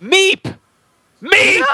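high squeaky meep; squeaky character name. Pretend. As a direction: chirp the name like a tiny creature. Naming the character playfully.

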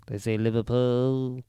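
a self-described terrible attempt at a Scouse "Liverpool"; "Liverpool" said slowly with an unsure Liverpool accent. Shown as a demonstration. Showing a friend how Liverpool locals say it, in a bad Liverpool accent. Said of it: "It's probably a terrible version of it".

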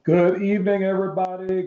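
big, high booming hello to the congregation; loud greeting. Real, not acted. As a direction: call out the welcome warmly to the church. Husband greets the church audience.

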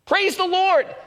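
very high, excited cry; jubilant praise. Acted. Elderly lady jumping and clapping her hands, jubilant high cry. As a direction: exult at a high pitch. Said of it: "started jumping up all the more and clapping her hands".

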